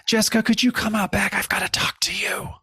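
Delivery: acted urgent aside, ending in a whisper; scared.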